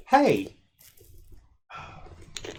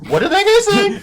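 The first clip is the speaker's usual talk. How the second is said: baffled audience member; 7 dB louder than usual. Retold or imitated. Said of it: "and you're just like".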